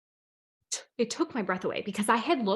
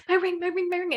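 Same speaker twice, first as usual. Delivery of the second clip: high, squeaky excited repetition; pitched-up mock frenzy; retold frantic excitement; 10 semitones higher than usual. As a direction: act out breathless excitement in a high voice.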